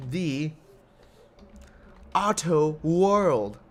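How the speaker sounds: slow, grand showman's intro; mock-posh show opening, very slow; fancy. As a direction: drag each word out like a pompous host. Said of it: "That was very fucking fancy".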